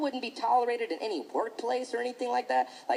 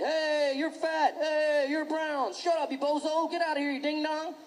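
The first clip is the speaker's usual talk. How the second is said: put-on higher, sing-song childish taunts; mock playground teasing; 5 semitones higher than usual. Kid teasing classmates, higher and sing-song. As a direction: tease like a silly kid.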